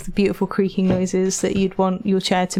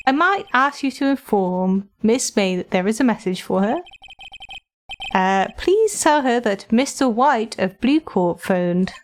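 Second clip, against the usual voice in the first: polite bellboy. Acted. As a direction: deliver the message like an eager bellboy. Bellboy character voice.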